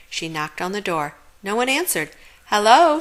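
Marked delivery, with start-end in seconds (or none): none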